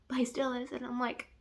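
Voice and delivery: whispered guilty confession; whispering. Real, not acted. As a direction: confess it in a hush.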